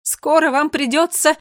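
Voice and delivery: slow, high, loud call; calling after them. A narrator's reading. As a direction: shout it after departing children. Queen calls after departing children.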